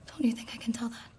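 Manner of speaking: soft unvoiced whisper. Presses softly in private.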